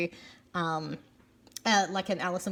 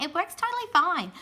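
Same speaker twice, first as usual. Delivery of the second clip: breezy confidence; 8 semitones higher than usual. Retold or imitated. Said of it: "I was like".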